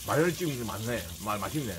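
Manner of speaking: usual speaking voice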